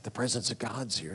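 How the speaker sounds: hushed, low and soft retelling; low tones. Retold or imitated. Preacher retelling what he told his just-woken wife, in low tones. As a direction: keep it low, as if beside a sleeper. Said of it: "I talked in low tones".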